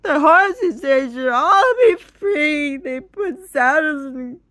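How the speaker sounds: very high, loud, slow crying wail; hammed-up blubbering plea; sobbing wail. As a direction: drag it out through mock sobs. Past self crying at friend.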